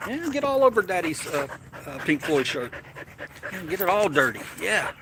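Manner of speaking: high, sing-song doggy talk. Real, not acted. Fussing over the dog climbing on his shirt, in high baby talk. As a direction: coo at the puppy like a proud owner.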